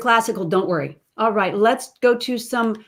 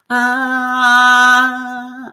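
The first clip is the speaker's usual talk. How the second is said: sung, loud and higher; nasal shift; 4 semitones higher, 6 dB louder than usual. Shown as a demonstration. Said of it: "Nasality shifts".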